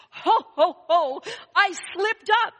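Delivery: loud, high ho ho ho; jolly Santa. Acted. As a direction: boom it out like Santa laughing at himself. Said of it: "I said".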